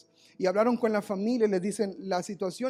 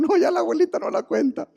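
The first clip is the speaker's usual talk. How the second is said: much higher, loud mock lament; relatives giving up; 11 semitones higher, 10 dB louder than usual. Relatives at the bedside despair, loud mock lament. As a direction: cry out that she won't make it. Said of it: "ya dijeron".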